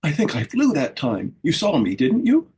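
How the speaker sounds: proud and breathless after the fall; sputtering excitedly. A narrator's reading. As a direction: caterpillar excited after the splash. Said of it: "sputtering excitedly".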